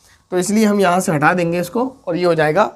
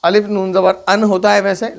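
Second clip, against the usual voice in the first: ordinary talk, nothing marked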